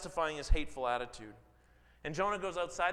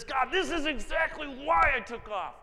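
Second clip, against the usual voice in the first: much higher and louder, indignant protest; Jonah's indignant outburst; 11 semitones higher, 8 dB louder than usual. Acted. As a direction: blurt it out like a sulking prophet. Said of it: "He says".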